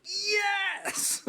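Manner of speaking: high-pitched, delighted yes; excited cheer. Real, not acted. Host applauds guest's radio shout-out, excited cheer. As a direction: cheer it in approval.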